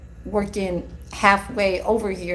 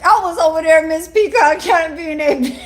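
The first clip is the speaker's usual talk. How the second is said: giggling through the words, higher and louder; amused self-mockery, laughing; 6 semitones higher, 4 dB louder than usual. Real, not acted. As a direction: laugh at your own nosiness. Laughing at herself nosy.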